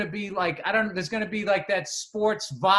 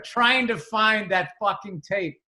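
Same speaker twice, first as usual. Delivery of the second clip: usual speaking voice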